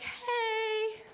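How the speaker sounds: awkward high hey. Retold or imitated. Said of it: "being like".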